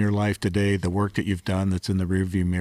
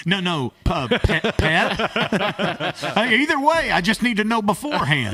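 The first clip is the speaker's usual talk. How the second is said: high mock customer; 11 semitones higher than usual. Playing customer joke.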